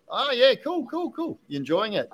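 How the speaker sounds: replaying his own upbeat reply to the kid; light retold cheerfulness; imitating himself chatting. Retells chat with young kid.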